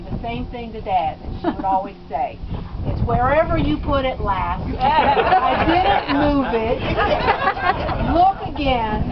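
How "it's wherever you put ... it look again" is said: a hint of mom scolding dad, higher and louder; lightly voiced exasperated mother telling him to look again; mother's voice. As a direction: daughter slipping into her late mother's nagging voice with dad. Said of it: "I hear my mother's voice".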